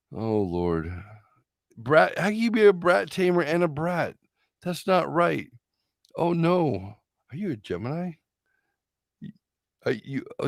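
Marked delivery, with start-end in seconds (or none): none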